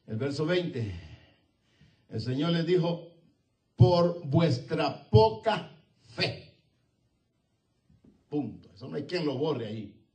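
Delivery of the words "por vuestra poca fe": slow solemn reply. Acted. Jesus' reply, weighty.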